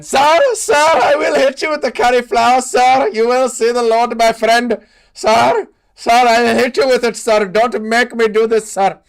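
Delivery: high, loud put-on Indian accent pleading "sir"; hammed-up Indian accent, begging and threatening; mock Indian accent.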